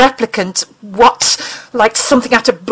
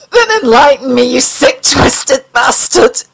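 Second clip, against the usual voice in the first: wheezing anger; 5 semitones higher, 4 dB louder than usual. Acted. Mulder demands the alien explain itself, angry and strained. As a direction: force it out through pain. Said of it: "he wheezed angrily".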